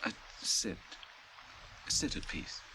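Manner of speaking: whispered, gentle settling; hushed calming. Acted. Faramir to Sam after his slip, whispered calm. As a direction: soothe him into sitting.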